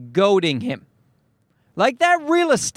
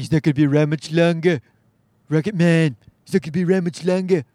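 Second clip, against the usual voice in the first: lazy, fast, lightly voiced Trump; a half-hearted Trump, barely trying; Trump impression; 6 semitones lower, faster than usual. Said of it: "can't even be bothered to try to do a good Trump impression".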